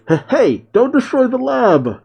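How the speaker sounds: high-pitched yell without real volume; mock alarmed yell. Doctor Hedo protests as his robot fires, mock high yell. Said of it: "Hedo yells".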